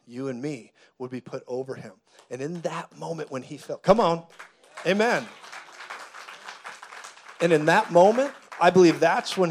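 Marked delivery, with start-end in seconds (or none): none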